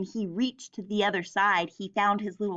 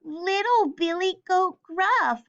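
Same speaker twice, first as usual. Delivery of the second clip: high, small billy goat voice; little goat voice; 8 semitones higher than usual. A narrator's reading. Little goat answers the troll in a high small voice.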